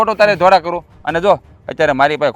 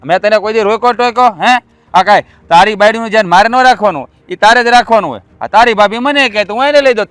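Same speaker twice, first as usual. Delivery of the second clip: louder, higher heated scolding; raised-voice rebuke; angry; 4 semitones higher, 6 dB louder than usual. Acted. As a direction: scold the brother hotly. Brothers quarrel over money.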